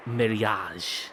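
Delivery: "mariage" said the French way; French pronunciation. Shown as a demonstration. Reader wondering if it's French, French pronunciation. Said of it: "now that I'm thinking in French".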